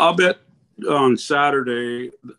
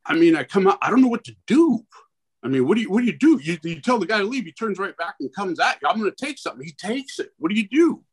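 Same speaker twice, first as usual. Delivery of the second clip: high-pitched, helpless venting; exasperated; 6 semitones higher, faster than usual. Real, not acted. Business owner at his wits' end venting to officials, exasperated. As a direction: throw up the questions, pitched well above usual.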